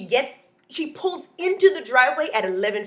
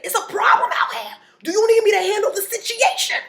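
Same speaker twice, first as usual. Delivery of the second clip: high and loud, itching for a fight; hammed-up neighbor offering to handle it; eager neighbor; 11 semitones higher, 5 dB louder than usual. Neighbor runs out with machete.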